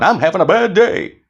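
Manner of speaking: high cartoonish mock gloom; mock silly. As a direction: squeak it out absurdly. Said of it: "stand up like this going".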